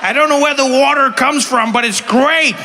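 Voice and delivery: high, loud cheerful mock local; gleeful toxic-dump swimmer; high loud hammed-up local; mutant swimmer.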